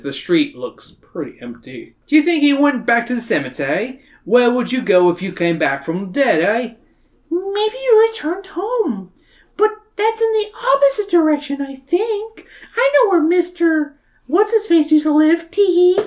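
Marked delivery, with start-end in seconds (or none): 2.1-6.7 sarcastic Australian bloke; Australian accent. Acted. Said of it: "great Australian accent like I can". 7.3-16.0 put-on very high girlish Mina voice.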